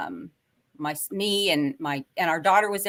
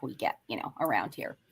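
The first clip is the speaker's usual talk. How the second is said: hushed aside; 9 semitones lower, 9 dB quieter than usual. Real, not acted. To the hosts about odd local happenings, a secret whisper. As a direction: drop to a whisper on around here. Hinting at strange local stuff.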